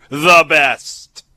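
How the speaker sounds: big booming boast; loud emphatic boast. Acted. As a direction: punch it out loud. Emphatic podcast plug.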